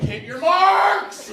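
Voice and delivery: high, slow, loud coach shout; yelling at actors like a hockey coach. Retold or imitated. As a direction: bark it like a sideline coach. Coaching theater kids from sidelines.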